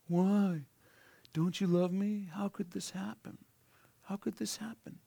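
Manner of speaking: high, soft whiny pleading; a hint of pouting complaint; mock whining. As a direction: whimper it like a hurt child. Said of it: "are we whining".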